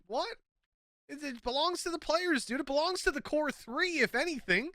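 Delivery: high-pitched incredulous rant; rant, pitch shooting up in disbelief; exasperated rant. Real, not acted. Ranting at league decision.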